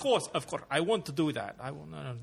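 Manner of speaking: eager dictator in a put-on Russian accent; mock Russian accent. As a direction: fake Russian accent, protesting too readily. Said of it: "It sounded like the Russian accent there".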